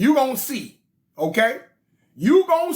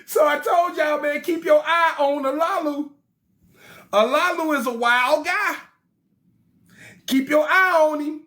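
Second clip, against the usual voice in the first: hyped warning; 5 semitones higher than usual. Real, not acted. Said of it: "warning the audience".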